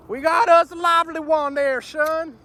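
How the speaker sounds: high, loud Southern fishing drawl; sudden southern drawl. Real, not acted. Southern drawl as he cheers a bite to the viewers. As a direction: slips into a hammed-up Southern twang. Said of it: "I just turned southern all of a sudden".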